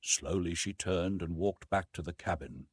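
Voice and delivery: plain reading voice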